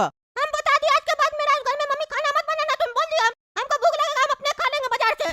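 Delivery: high-pitched cartoon child voice; squeaky kid brushing someone off; high, stubborn child; defiant little boy.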